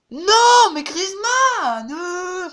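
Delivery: loud, high, drawn-out outcry; angry shout. Real, not acted. Gamer at his striker wasting chances, loud high outcry. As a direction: cry out at the wasted chance.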